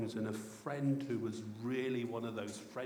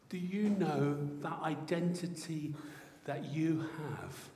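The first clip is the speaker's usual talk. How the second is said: ordinary talk, nothing marked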